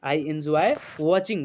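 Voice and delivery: words stretched very slowly; very slow read-aloud. Shown as a demonstration. Teacher reading the sentence slowly for students to repeat. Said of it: "देखो set हो रहा कि नहीं".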